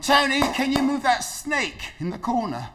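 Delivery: put-on high, slow voice of a confused elderly lady; elderly client asking the visitor to remove a snake; confused old lady. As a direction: ask it high and slow, puzzled and sincere. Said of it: "and she went".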